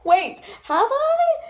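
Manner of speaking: high, slow, drawn-out disbelief; stunned realization. Real, not acted. Reader realizing a mistake mid-reading, stunned. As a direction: voice shoots up as it dawns on you.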